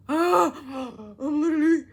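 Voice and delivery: loud gasp, slow and high; speechless, slowed outraged gasp; gasping outrage. Real, not acted. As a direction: choke on disbelief.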